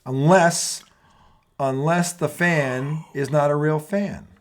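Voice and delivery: slow, deliberate build into a rant; rant opener. Acted. Host opens his tonight rant segment, slow and deliberate. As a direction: set up the rant, taking time.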